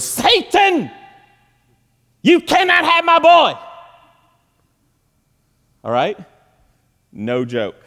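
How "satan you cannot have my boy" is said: high, loud mock exorcism cry; mama's rebuking shout. Preacher imitates mama rebuking Satan over her boy, rebuking shout. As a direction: imitate a mother yelling down the devil. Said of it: "immediately she goes".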